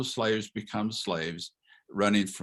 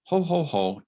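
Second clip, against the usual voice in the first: mock jolly ho-ho, raised in pitch; dismissive chuckle; 7 semitones higher, 3 dB louder than usual. Lecturer imitating a stock Korean answer, mock dismissive chuckle. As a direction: brush the question off with a put-on laugh. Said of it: "The Korean answer is".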